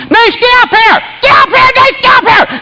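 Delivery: very high, frantic holler; screaming. Retold or imitated. Man hollering for help with the flood, screaming. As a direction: imitate yelling upstairs in a panic. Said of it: "I just started screaming".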